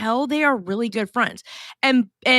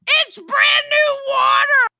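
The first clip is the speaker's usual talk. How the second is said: much higher and louder, yelling in exasperation; exasperated shout; 12 semitones higher, 5 dB louder than usual. Real, not acted. Owner shouting at her needy cat about brand new water, exasperated yell. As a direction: bellow it at the cat, fed up.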